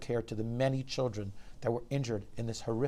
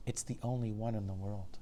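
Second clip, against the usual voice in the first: softer, weary answer; lightly voiced sighing goldsmith; subdued old man; 6 dB quieter than usual. A narrator's reading. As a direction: answer quietly, with resignation. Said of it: "The man sighed".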